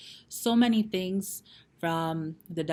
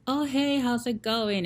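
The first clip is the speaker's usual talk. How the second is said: bright, higher, chirpy hello; cheery greeting; 7 semitones higher than usual. Retold or imitated. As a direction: greet him all sunny and upbeat. Girlfriend greets him cheerily.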